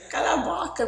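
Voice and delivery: high laughing scold in Portuguese. Real, not acted. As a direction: tell her to shut up through laughter.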